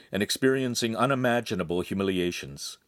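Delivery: ordinary delivery, nothing marked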